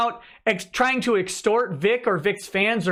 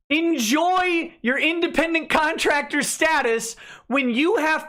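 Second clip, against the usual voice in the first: sarcastic scolding; 5 semitones higher than usual. Acted. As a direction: berate an absent idiot, stretching the words. Sarcastic scolding of absent person.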